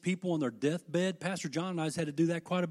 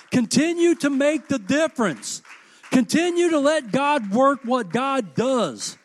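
loud, higher, fervent build to the close; preaching at full force; 7 semitones higher, 9 dB louder than usual. Real, not acted. Pastor urging the congregation with loud fervent preaching. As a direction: shout it out as a rousing climax.

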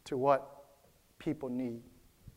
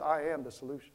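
softer, low private mutter; mumbled under breath; 4 semitones lower, 9 dB quieter, faster than usual. Shown as a demonstration. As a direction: say it under your breath to yourself. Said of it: "mumble under your breath".

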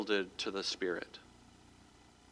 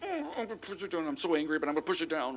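strained, higher mock grumble, holding it in; mock bottled-up anger; 6 semitones higher, 7 dB quieter, faster than usual. Pastor mimics a churchgoer forcing anger down, tight and strained. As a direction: play someone swallowing their anger. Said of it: "controlling them yourself and going".

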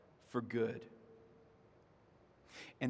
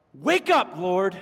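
higher, louder desperate call; screaming to God; 3 semitones higher, 9 dB louder, slower than usual. Acted. Sons of Korah crying out to God, loud desperate call. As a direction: cry it up at the sky. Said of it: "looking up at the sky and screaming".

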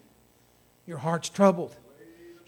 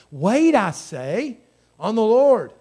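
"Wait, I say" drawn out, then louder "on the Lord"; emphatic accenting; 3 semitones higher, 6 dB louder, slower than usual. Acted. Psalmist saying it one more time, "Wait" heavily accented. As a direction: lean slowly on "Wait", punch "on the Lord". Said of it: "he's accenting this".

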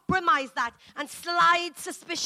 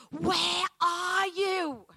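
slow, gentle and higher, a loving father searching; tender calling; 6 semitones higher, much slower than usual. Retold or imitated. Preacher voicing God pursuing us in love, slow and tender. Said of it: "he pursues us in love".

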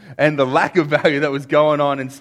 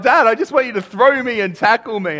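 put-on child pleading; 8 semitones higher, 6 dB louder than usual. Son asking dad to play.

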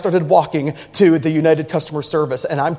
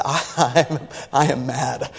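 laughing; 5 semitones lower, 6 dB quieter than usual. Retold or imitated. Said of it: "I put a smile on my face".

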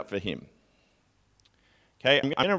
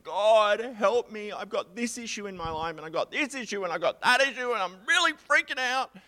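higher, mock-frantic plea to God; hammed-up anxious prayer, climbing higher; panicky pleading; 10 semitones higher than usual. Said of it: "I'm like".

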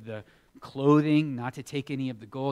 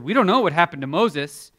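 mock pushy friends; pressuring friends; 4 semitones higher, 10 dB louder than usual. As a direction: push him to make a god. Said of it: "His friends came to him and said".

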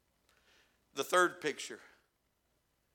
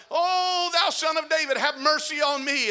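high, loud desperate plea; crying out; 8 semitones higher, 7 dB louder than usual. Retold or imitated. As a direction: call out to be heard over the crowd. Blind man cries out.

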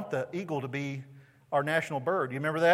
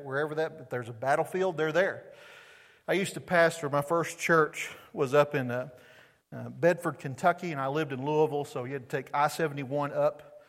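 ordinary talk, nothing marked

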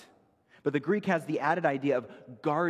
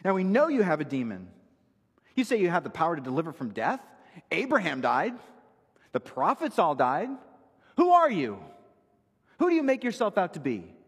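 louder and higher, rising to an outraged "Who are you?"; indignant accusation; 5 semitones higher, 6 dB louder than usual. Retold or imitated. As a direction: sneer the challenge as if you hold the upper hand.

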